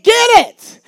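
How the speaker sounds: high, loud, sharp command; shouted command. Acted. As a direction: bark it at the church.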